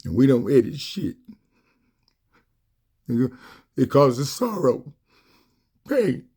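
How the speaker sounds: fighting back sobs, pushing the words out; crying. Real, not acted. Speaking to his viewers about lost loved ones, crying for real. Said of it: "I'm getting emotional now".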